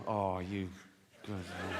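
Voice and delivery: very soft, low, fond praise; acted tender dad; proud dad. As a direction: murmur approval to your son. Acts proud dad praising Jack.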